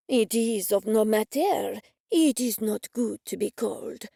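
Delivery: put-on slow, mild French-accented chef; fake French accent. Chef waving them through, mild French accent. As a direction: shrug it off in a light French accent. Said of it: "that fake French accent".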